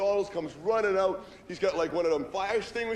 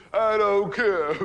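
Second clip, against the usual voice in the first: slow stubborn refusal; 3 semitones higher, 7 dB louder, much slower than usual. Retold or imitated. Groom refusing his family, stretched out slow and stubborn. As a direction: draw every word out, much slower.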